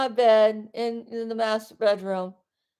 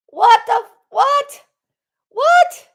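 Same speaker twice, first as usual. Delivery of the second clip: rising, much higher disbelieving what; incredulous outburst; 11 semitones higher, 8 dB louder than usual. Real, not acted. Streamer baffled by men who talk down women, rising in disbelief. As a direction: climb higher on each what, stunned.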